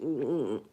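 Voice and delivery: unvoiced sly murmur; knowing whispered hum. Real, not acted. Hinting to co-hosts about guns, whispered hum. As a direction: hum it under your breath, knowingly.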